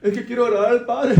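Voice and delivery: high, mock tearful whine with a laugh; hammed-up crying churchgoer; mocking a weepy believer. Preacher mocks a believer who sobs to please God, mock weepy whine.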